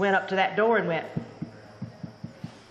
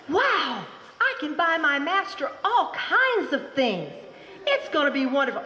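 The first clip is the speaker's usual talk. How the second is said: higher, laughing delight; a rooster thrilled with his penny; excited rooster; 7 semitones higher than usual. Acted. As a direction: bubble over with joy at the find. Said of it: "He was so excited".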